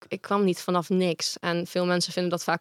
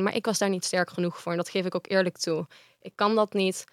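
ordinary talk

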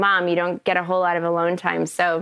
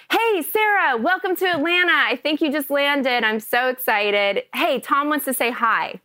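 high-pitched imitated phone greeting, gradually settling; excited welcome; 5 semitones higher than usual. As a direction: gush a welcome, starting high. Retold excited welcome call from director.

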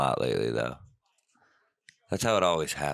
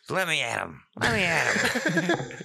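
high, slow little scrappy dog voice; tiny scrappy dog; 12 semitones higher, slower than usual. Pretend. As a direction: do a tiny dog itching to fight. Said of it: "a little scrappy dude down there".

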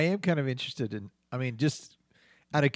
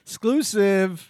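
dramatic announcement; 5 semitones higher, 5 dB louder, much slower than usual. Acted. Mock news teaser.

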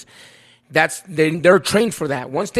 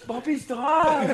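higher, very slow, laughing mock plea; imitating Papi; 6 semitones higher, 7 dB quieter, much slower than usual. Mimicking Papi begging to stop, slow, higher, laughing. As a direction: stretch it out slowly in a friend's higher voice. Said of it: "He's like".

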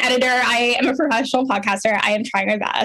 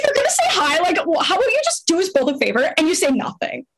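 mocking retort; 5 semitones higher than usual. Mocking a guy's lazy message.

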